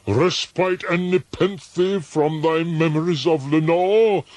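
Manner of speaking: high, loud pleading, slowing at the end; anguished cry. A narrator's reading. Narrator begs himself to forget Lenore, crying out. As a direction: cry out, then sink into longing. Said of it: "I cried".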